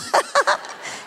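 high amused laugh. Real, not acted. Laughing after imitating the girls, amused laugh. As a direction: laugh after landing the joke. Laughs at her own joke.

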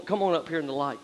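normal talking voice

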